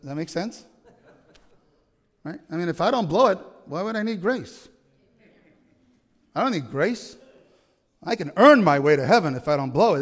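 usual speaking voice

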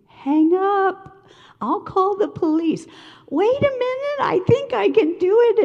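high, loud, urgent pleading with a coworker; frantic pleading. Retold or imitated. Pleading with a coworker on the phone, high and frantic. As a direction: beg her to hang up, voice climbing high. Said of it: "And I kept saying".

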